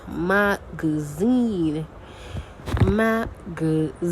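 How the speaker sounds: very slow "magazine", broken into syllables with a long -zine; "magazine" syllable by syllable, the middle one weak and short; syllable stress demo. Shown as a demonstration. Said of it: "So that's the stress".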